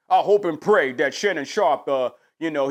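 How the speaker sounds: normal talking voice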